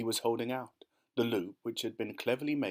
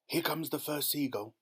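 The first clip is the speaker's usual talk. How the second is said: plain reading voice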